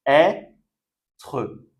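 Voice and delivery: ÊTRE split into "è", pause, "tre"; syllable by syllable. Shown as a demonstration. Teacher showing how ê sounds, "être" in two parts. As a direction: sound out "être" with a separate open "è". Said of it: "on prononce est".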